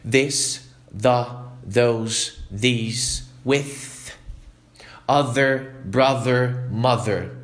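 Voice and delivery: This, the, those, these, with said with the tongue between the teeth; other, brother, mother with a voiced th; careful English th demo; English th sounds. Shown as a demonstration. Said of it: "Presta atenção só".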